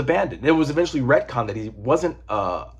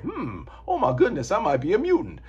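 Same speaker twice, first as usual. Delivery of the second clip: higher-pitched mock wondering; pondering; 8 semitones higher than usual. Falcon wondering if he's a mutant, mock pondering. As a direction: act out a dawning realization, pitched up. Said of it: "he ponders that".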